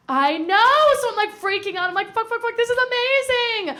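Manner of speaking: high, loud giddy shriek; giddy squeal. Retold or imitated. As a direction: squeal like a thrilled teen. Thrilled recalling crush's confession.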